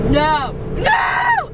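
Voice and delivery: high, loud panicked cry; imitated panic. Friend getting a prank call in the car, imitated panic. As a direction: cry out as if freaking out, imitating her. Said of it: "she just starts freaking out".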